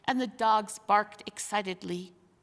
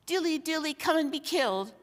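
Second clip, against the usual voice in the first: sneer; 7 semitones higher, faster than usual. Acted. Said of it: "sneered Griffle".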